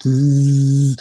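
loud sustained mimicked buzz; microwave buzz. Son microwaving burgers, loud imitated buzz. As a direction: imitate a humming machine, loud and steady.

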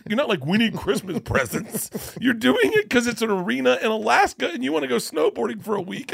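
husband parodies wife's scolding, high and loud; mock wife, scolding. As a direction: play the wife telling him off. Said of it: "She's like".

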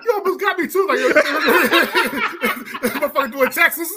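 words broken by high laughter; cracking up mid-sentence; laughing. Real, not acted. Cracking up over joke.

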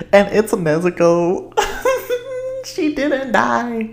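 high, slow, emotional squeal; drawn-out high cry of joy; overcome with emotion. Real, not acted. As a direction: let the relief burst out in a laugh. Overjoyed retelling of the twist.

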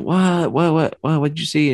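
lightly voiced mom pressing for answers; worried mom. Mom asking her scared son, lightly worried.

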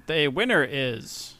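ordinary talk, nothing marked

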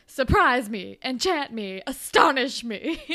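hammed-up 1930s drama teacher demanding to be dazzled; old-time theater lady. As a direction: declaim it like a cartoon theater person of the thirties. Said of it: "speaks like a cartoon version of a theater person in the nineteen thirties".

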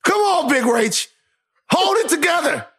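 high, stretched-up pleading call; urgent pep talk. Pretend. Host urging cohost to hold it together, high pleading.

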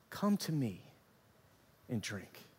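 soft, low, dropping away on "drink"; hushed invitation. Retold or imitated. Preacher quoting Jesus to the congregation, hushed invitation. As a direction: offer it quietly, letting the last words sink.

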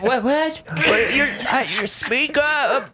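put-on high, feeble dying old Englishman. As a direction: croak like a dying medieval peasant. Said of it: "I have to do my dying English voice".

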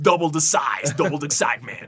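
loud hammed-up commercial voice; over-the-top promo hype; mock ad announcer. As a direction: shout the slogan like a cheesy advert. Mock ad slogans for the contest.

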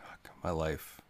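soft breathy whisper of defeat; whispered despair. Pretend. Down-on-his-luck music agent muttering to himself, whispered. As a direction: whisper it to yourself, beaten.

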